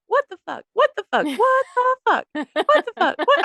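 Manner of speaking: put-on high little toddler voice repeating the phrase; mom's story of the toddler cursing from the back seat; tiny child's voice. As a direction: chirp it over and over like a toddler in a car seat. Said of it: "little tiny voice coming from the back seat".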